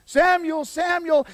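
high, loud call. Acted. God calls the boy the fourth time, high loud calling.

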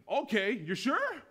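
high, loud, drawn-out disbelief; stretched, astonished question; bewildered commandant. Acted. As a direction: give him one last incredulous chance. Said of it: "bewildered, shocked, and awe".

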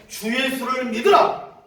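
slow, high, very loud proclamation; booming street-preacher shout. Retold or imitated. Preacher startling passers-by on the street, booming shout. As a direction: belt it out to a whole street. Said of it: "내가 목소리 엄청 큰 거 아세요?".

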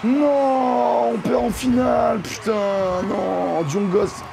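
non crié en montant, désespéré; cri de désespoir. Real, not acted. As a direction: crie ta défaite. Défaite en finale. (cri désespéré et aigu)

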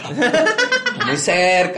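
stretched high and slow, spooky; high slow mock warning. Friend warning the German about rain, high slow and spooky. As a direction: drag it out high like a ghost warning.

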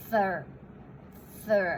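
"thir" with TH run into R; blended TH-R. Shown as a demonstration. Teacher joins the sounds for learners, "thir" as blended TH-R. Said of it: "พอพูดเร็วๆปุ๊บนะคะ".

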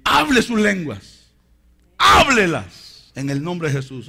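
very loud, drawn-out command; forceful exhortation. Real, not acted. Preacher urging believers to speak in tongues, forceful shout. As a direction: call it out with full force.

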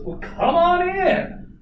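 high, loud, drawn-out hollered welcome; booming Southern dad. Retold or imitated. Girlfriend's dad invites the suitor inside, big booming welcome. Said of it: "And he goes".

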